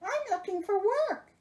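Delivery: high squeaky monster voice. Acted. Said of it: "he said".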